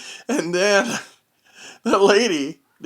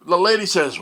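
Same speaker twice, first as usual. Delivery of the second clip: ordinary delivery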